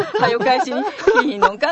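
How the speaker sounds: a blunt, laughing Kyoto scolding; real meaning, blunt. Retold or imitated. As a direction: say out loud what the sweet words really mean. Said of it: "って言ってるみたいなもんで".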